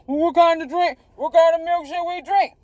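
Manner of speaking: high, nasal-free mock of a clueless follower; whiny mocking commenter. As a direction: ape the dumb question in a high voice.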